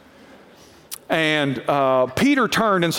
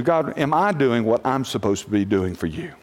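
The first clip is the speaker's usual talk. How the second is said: humble prayer; 7 semitones lower than usual. Shown as a demonstration. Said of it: "The only thing you have to answer to".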